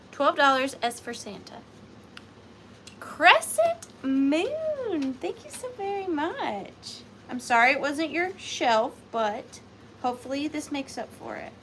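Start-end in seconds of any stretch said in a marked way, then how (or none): 3.0-7.0 high, drawn-out call of the name; effusive thanks. Real, not acted. Seller thanks Crescent Moon for the purchase, effusive. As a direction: sing out a big thank-you.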